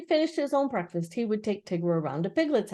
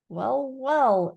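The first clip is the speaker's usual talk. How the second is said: careless; 5 semitones higher than usual. Acted. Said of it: "in a careless sort of voice".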